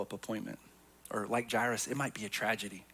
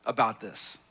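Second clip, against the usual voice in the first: desperate father pleading; 6 semitones higher, 6 dB louder than usual. Retold or imitated. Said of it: "And he came".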